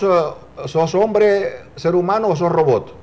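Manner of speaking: ordinary talk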